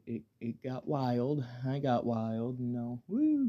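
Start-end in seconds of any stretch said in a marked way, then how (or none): 3.1-3.5 high whooping cheer; party whoop. Retold or imitated. Recalling a wild night, a high excited whoop. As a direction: let out a high party woo.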